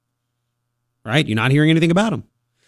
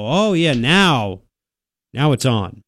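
sarcastic; 2 semitones lower, slower than usual. Real, not acted. Host mocking council's sudden action.

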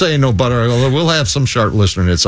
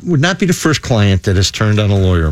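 ordinary talk

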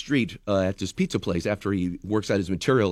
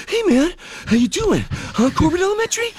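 much higher bro-ish announcer voice; a buddy-buddy school announcement; Mark Wahlberg. Pretend. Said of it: "Mark Wahlberg ... elementary school announcements".

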